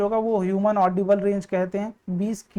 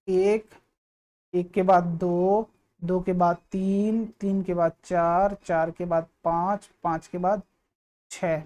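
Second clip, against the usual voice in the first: slow counting; slower than usual. Shown as a demonstration. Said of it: "counting cycles aloud slowly".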